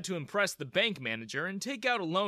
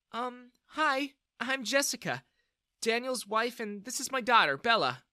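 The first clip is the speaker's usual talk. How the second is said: higher-pitched woman's voice, hesitant start; older lady; 9 semitones higher than usual. A narrator's reading. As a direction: introduce yourself and daughter politely. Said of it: "The older lady introduced".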